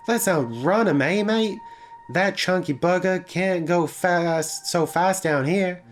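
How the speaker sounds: high-pitched, hammed-up gleeful accent; maniacal gleeful driver. A narrator's reading. Driver to passenger outrunning the pursuer, maniacal glee. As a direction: crow it with wild glee. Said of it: "she cackled maniacally".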